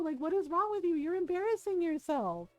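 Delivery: retold yelling. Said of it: "yelling at her".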